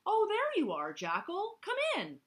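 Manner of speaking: high, bright party-host greeting; cheerful Zebra. Acted. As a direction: welcome him in a high friendly voice.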